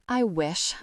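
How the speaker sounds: plain reading voice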